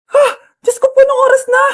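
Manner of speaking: high, loud panic over the time; sudden panic; startled cry. Acted. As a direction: jolt in alarm at the hour. Mother realises it is late.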